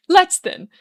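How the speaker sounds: high, loud eager cry; impatient burst. A narrator's reading. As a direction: blurt it out, can't wait.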